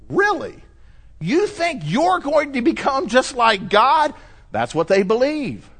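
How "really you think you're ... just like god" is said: incredulous. Real, not acted. Incredulous rebuttal to Mormons.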